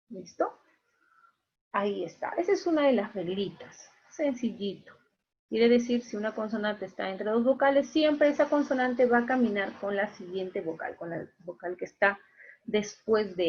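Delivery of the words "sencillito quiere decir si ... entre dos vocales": ordinary talk, nothing marked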